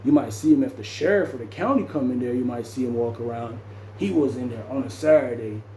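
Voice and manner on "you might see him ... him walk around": ordinary talk, nothing marked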